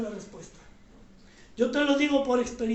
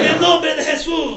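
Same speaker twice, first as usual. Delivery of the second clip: much louder, calling out each line; shouted prayer lead; 8 dB louder than usual. Real, not acted. Pastor leads the standing congregation line by line, shouting. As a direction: shout the prayer for the church to echo.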